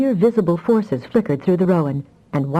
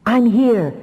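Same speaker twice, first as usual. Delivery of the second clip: higher, louder reassuring call; 6 semitones higher, 4 dB louder than usual. A narrator's reading. Afra calling out to his frightened daughter. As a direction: call across to the child. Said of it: "Afra called".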